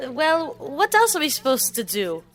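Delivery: ordinary talk, nothing marked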